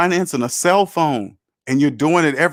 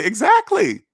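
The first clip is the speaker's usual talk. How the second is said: triumphant agreement; 14 semitones higher than usual. Real, not acted. Agrees with imagined listener's realization.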